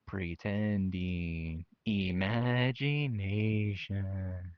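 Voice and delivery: very slow, dreamy stretch; slow, theatrical drawl on pretending; drawn out. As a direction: savour each word slowly. Dramatic word stretch.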